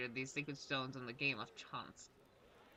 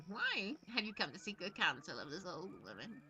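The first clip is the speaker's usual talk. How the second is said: lightly voiced old woman; granny voice; 5 semitones higher than usual. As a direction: croak it like a granny. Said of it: "use my granny voice".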